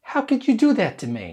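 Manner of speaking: fast, high accusation falling at the end; angry reproach. Shown as a demonstration. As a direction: throw it out as a rapid, hurt accusation. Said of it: "we usually say it like this".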